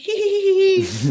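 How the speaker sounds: imitating a weird little high-pitched giggle; high, squeaky hee-hee giggle impression; mock high giggle. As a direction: titter like a big man giggling in your ear. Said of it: "start doing this weird little giggle".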